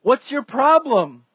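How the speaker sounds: acted indignant insistence; frustrated, irritated. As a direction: argue back, slowing down to drive the point home. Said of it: "he's getting frustrated and irritated".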